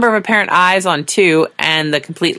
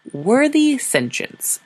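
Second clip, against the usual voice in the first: slow, higher, formal address; diplomatic voice; 9 semitones higher, much slower than usual. A narrator's reading. Sora addressing the alien envoys, slow and diplomatic. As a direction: sound like a careful diplomat. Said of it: "in her best diplomatic voice".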